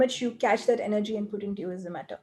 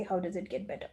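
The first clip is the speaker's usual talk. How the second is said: quieter, sinking question with a falling end; deflated, defeated tone; 3 semitones lower, 9 dB quieter than usual. Shown as a demonstration. Coach demonstrating the wrong, defeated way to ask, low and quiet. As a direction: ask it as if all hope is gone. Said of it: "And then with that energy, I say".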